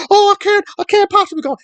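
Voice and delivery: high, mocking whine of her excuse; mock whiny woman. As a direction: squeak it out as a mocking impression.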